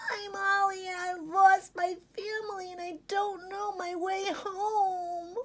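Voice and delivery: high, slowing, tearful squeak; squeaky puppy. Acted. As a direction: squeak it like a scared lost pup. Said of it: "Ollie squeaked".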